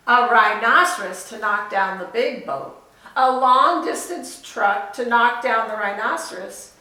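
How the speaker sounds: loud, emphatic read-aloud list; storybook reading. Acted. Teacher reading the absurd list to children, emphatic. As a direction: read each item with relish.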